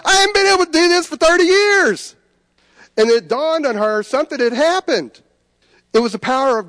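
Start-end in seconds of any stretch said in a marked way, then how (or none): 0.1-2.1 high, loud amazed telling; amazed exclaim. Retold or imitated. Healed man telling his wife about his arm, amazed. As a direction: burst out in wonder. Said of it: "And he's like".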